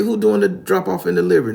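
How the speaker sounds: loud, high-pitched caper talk; hammed-up movie tough talk; seventies movie character. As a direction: play a seventies crime flick, big and loud. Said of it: "We talking like we in the seventies movie".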